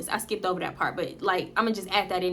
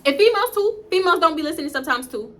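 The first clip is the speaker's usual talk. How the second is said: emphatic aside; 6 semitones higher, 4 dB louder, faster than usual. Real, not acted. Sudden high emphatic aside.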